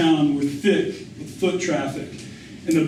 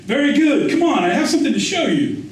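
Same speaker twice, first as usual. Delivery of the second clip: put-on high, quick coaxing of a young tout; pushy street boy; 8 semitones higher, 3 dB louder, faster than usual. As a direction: hurry him along, pitched well up. Boy urging narrator onto moped.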